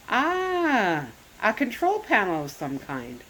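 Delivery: ordinary delivery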